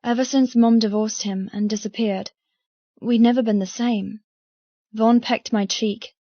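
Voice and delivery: usual speaking voice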